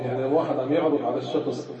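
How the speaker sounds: usual speaking voice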